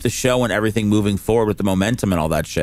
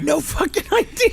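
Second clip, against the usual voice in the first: high and laughing through it. Retold or imitated. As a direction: laugh out the clueless answer. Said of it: "I go".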